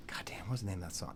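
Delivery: whispered to himself; searching for a song title, whispering. Real, not acted. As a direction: hush it under your breath.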